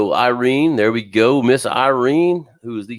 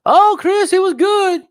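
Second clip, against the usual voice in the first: put-on high, loud old lady voice; high mock Irene voice, pleased; imitating Irene; 5 dB louder, slower than usual. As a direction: squeak it out like a sweet older lady. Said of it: "I'm trying to talk like her".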